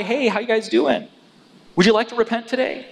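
high, chirpy mock politeness; mock friendly greeting. John as he was not, greeting Pharisees, mock friendly.

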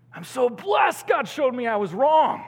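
high, loud burst of gratitude; exultant cry. Acted. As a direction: proclaim it with overflowing joy. Exultant take after the whispered one.

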